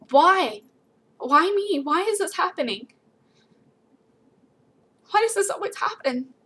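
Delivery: high, tearful, pleading voice; crying out in despair. Retold or imitated. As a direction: voice breaking with despair. Despairing cry over possible loss.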